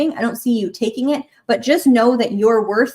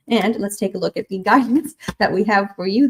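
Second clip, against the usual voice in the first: ordinary talk, nothing marked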